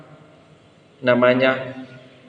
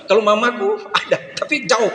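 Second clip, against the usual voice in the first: put-on laughing child; 7 semitones higher than usual.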